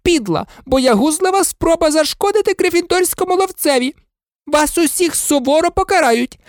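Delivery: high-pitched, outraged tirade; furious yelling. A narrator's reading. Professor McGonagall punishing the Slytherin tricksters, yelling furiously. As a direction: scold them high, livid and relentless. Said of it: "репетувала вона".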